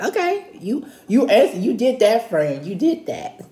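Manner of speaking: mock-nonchalant, high-pitched approval. Jesus casually answering the law expert, nonchalant. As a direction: toss it off high and unbothered. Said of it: "he's so nonchalant".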